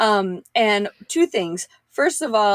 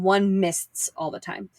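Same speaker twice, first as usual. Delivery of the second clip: usual speaking voice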